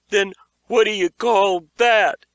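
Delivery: loud, high, frightened demand; panicked outburst. A narrator's reading. Beaver shouts at Josh about the monster, loud and panicked. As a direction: explode with fear. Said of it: "exploded in fear".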